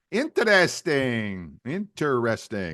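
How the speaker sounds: drawn-out, savoured catchphrase; Arte Johnson catchphrase. Acted. Host quoting a Laugh-In catchphrase, drawn-out impression. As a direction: stretch it slowly like a comic catchphrase. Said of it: "Arte Johnson from Laugh-In".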